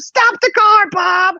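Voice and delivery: very high mock shriek of command; mom's urgent yell. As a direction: cry out like a panicked mom giving orders. Mom urgently orders dad to stop.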